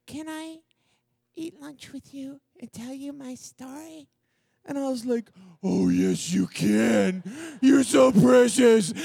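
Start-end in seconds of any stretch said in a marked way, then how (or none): 0.1-4.1 put-on high, hushed little-girl voice, shy and sweet; timid little girl. Cheerleader girl shyly asking the speaker to eat lunch with her, in a high hushed voice. 5.6-8.9 loud, slowed, over-the-top gushing; shower her with delight, almost shouting. Retold or imitated. Speaker telling the shy girl she is precious, in a loud, slow gush.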